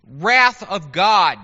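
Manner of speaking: slow, loud, mock-solemn prophet pronouncement; prophet speak. Preacher introduces Amos's wrath of God speech, slow prophet speak. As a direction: draw it out loudly like a prophet announcing doom. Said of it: "his prophet speak".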